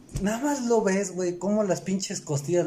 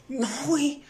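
exasperated; 10 semitones higher than usual. Retold or imitated. Scolding ASMR video eater.

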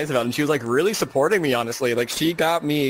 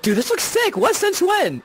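high-pitched excited exclaim; 3 dB louder than usual. Retold or imitated. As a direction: squeal it out in a high voice. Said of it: "And I was like".

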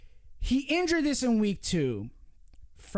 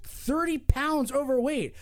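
ordinary delivery

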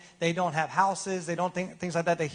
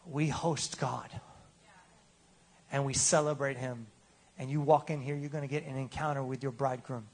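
Levi's quiet reply; 4 semitones lower, 6 dB quieter than usual. Retold or imitated. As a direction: answer gently, almost reverent. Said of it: "And Levi might say".

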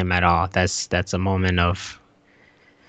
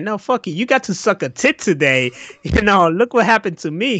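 higher mock Chucky scolding the others; hammed-up doll showing off his burnt hand; 10 semitones higher than usual.

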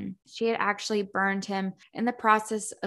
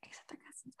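a very soft whispered aside; whispering a guilty joke to the listener; 27 dB quieter, much faster than usual. Real, not acted. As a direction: drop to a whisper.

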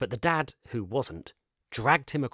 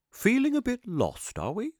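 higher, louder character voice, jolly and knowing; kindly eccentric shopkeeper; 8 semitones higher, 4 dB louder than usual. Acted. Shopkeeper teasing Kip gently about being lost. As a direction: charm the lost boy.

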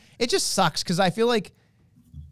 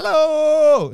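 loud, high shouted name call; announcer shout; 9 semitones higher, 6 dB louder, slower than usual. Pretend. Host echoes cohost with a loud announcer shout. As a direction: belt the name like a ring announcer.

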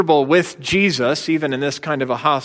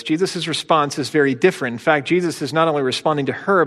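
ordinary talk

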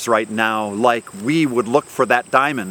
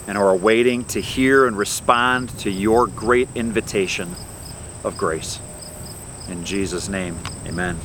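prayer; 3 semitones lower than usual. Real, not acted. Pastor asking God for a heart for the lost, prayerful.

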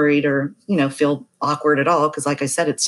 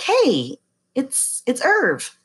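higher, slowed, drawn-out friendly hello; cheery caller voice; 9 semitones higher, much slower than usual. Retold or imitated. Old client phoning the masseuse out of the blue, a higher drawn-out hello. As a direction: stretch it out like a guy unsure he's remembered.

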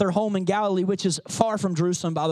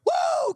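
joyful whoop. Real, not acted. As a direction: let out a whoop of joy. Preacher's burst of joy.

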